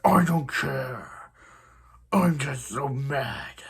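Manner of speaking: very slow, stretched-out Batman growl; hammed-up brooding superhero voice; Batman impression. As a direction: drag each word out as a parody Batman. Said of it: "stop doing your best Batman impression".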